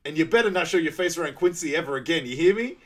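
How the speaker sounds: high, loud warning; mock angry yell. Tammy banishing him from Quincy, angry yell. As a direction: warn him off, shouting.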